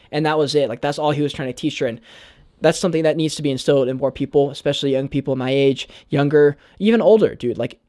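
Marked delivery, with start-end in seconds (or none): none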